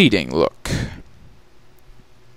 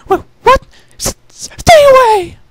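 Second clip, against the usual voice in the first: much higher, louder, stammering cry of fright; terrified shriek; 8 dB louder than usual. Acted. Rarity frightened by a noise in the dark, a high stammering scream. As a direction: shriek at the unseen thing.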